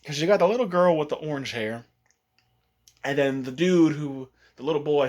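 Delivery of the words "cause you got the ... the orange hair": ordinary talk, nothing marked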